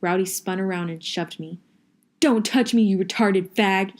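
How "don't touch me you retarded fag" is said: yelled. A narrator's reading. Rowdy yells at his best friend to back off, furiously. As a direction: snarl it at him as loud as you can. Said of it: "he yelled".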